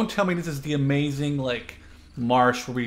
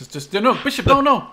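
rising, louder, higher cry of no; panicked no; 9 semitones higher, 8 dB louder than usual. Real, not acted. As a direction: stop a teammate in alarm. Teammate about to blunder.

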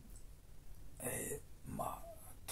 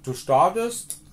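shouting; 2 semitones higher, 10 dB louder than usual. Real, not acted. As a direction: call it out to everyone. Shouting pilgrim wisdom.

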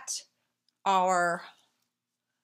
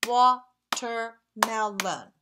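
"Watermelon" slowly in four separate beats; "Watermelon" evenly split into four; syllable by syllable; 4 dB louder, much slower than usual. Shown as a demonstration. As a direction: clap along to each syllable of "Watermelon". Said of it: "as we say them, let's clap".

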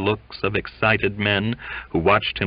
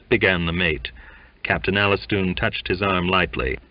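usual speaking voice

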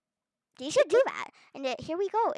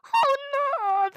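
alarmed oh no; 11 semitones higher, 8 dB louder than usual. Real, not acted. Sees danger ahead, dismay.

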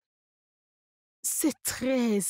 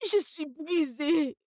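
heartbroken wail; 7 semitones higher, much slower than usual. Acted. Betrayed woman after the arrest.

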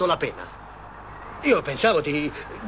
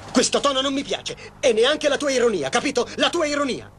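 angry; 8 semitones higher than usual. Acted. Angry lover scolding.

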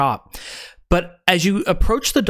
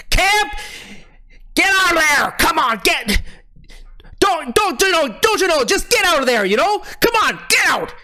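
high put-on voice shouting through a door; yelling; 12 semitones higher than usual. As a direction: bellow at the locked door in a high voice. Harbor master yelling through door.

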